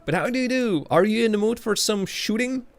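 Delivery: lightly put-on Spanish accent, pitched high; mock Spanish accent. Streamer asking a viewer about some shooting, mock Spanish accent. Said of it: "a Spanish accent".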